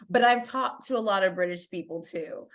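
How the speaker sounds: normal talking voice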